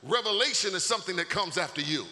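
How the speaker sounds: ordinary talk, nothing marked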